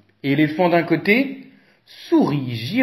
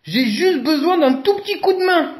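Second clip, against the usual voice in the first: high, louder request for help; elephant asking; 13 semitones higher, 3 dB louder than usual. A narrator's reading. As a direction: ask the crowd for a small hand. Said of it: "leur dit Éléphant".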